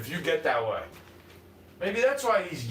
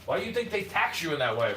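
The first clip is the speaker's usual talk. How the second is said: ordinary talk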